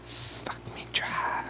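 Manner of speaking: slow breathy whisper. Acted. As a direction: breathe it out slowly. Whispered line.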